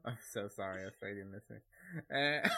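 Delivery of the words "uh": high squealing laugh. Real, not acted. Laughing apology to Australians.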